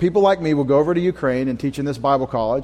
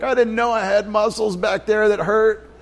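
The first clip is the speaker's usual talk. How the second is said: higher-pitched mock complaint; sore gym-goer; 5 semitones higher than usual. Preacher playing the day-after gym-goer, higher mock complaint. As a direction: lightly voice someone surprised by aching muscles.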